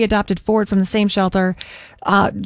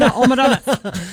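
dog voice. Pretend. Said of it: "my dog voice on the radio".

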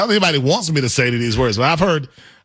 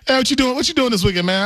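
higher, casual friend calling out; friend asking; 7 semitones higher, faster than usual. Pretend. Buddy asking about weekend plans, casual and upbeat. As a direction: call over like a buddy. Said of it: "let's say".

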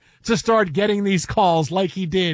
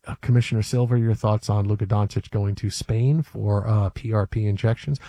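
deep reporter voice; 12 semitones lower than usual. Pretend. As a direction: play a reporter at a press conference. Mock reporter asks commissioner.

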